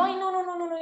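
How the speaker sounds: whiny refusal. Retold or imitated. Denying the signs she was getting, whining high. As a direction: refuse like a sulking child.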